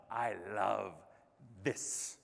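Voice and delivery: low, soft, slowly spaced words; hushed sincerity. Real, not acted. Speaker confessing love of keynotes to audience, hushed and sincere. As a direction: drop right down and let the last word fall almost to a whisper. Same declaration repeated low, softly.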